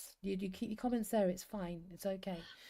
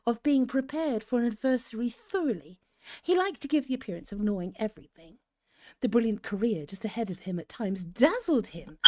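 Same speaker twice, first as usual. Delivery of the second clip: story narration; 3 semitones higher, 6 dB louder than usual. A narrator's reading. Host reading to cosy listeners, deliberate story narration.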